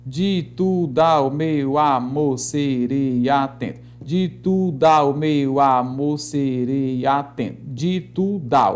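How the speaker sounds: slow metrical chant of the verse; drawn-out scansion rhythm; rhythmic, like music. Shown as a demonstration. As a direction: stretch each syllable to a steady beat. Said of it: "parece mesmo com uma música".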